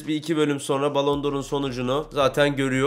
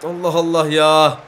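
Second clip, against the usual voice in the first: ordinary talk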